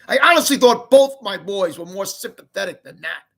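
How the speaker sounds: loud disappointed reproach; angry. Acted. Mom angry at both boys' unkindness. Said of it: "said Mom angrily".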